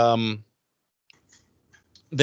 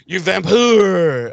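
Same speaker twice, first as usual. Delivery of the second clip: drawn-out, higher mock outcry; mock accusation; 5 semitones higher, 4 dB louder, much slower than usual. Co-host echoing the vamp insult to Buffy, mock accusing. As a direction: stretch it out as a theatrical insult.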